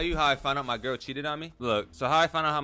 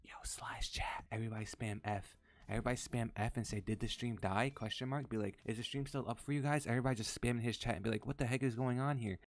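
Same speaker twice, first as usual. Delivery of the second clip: softer, hushed instructions to chat; conspiratorial low voice; 5 semitones lower, 10 dB quieter than usual. Real, not acted. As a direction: keep it down so the friend won't hear. Secretly instructing chat to prank friend.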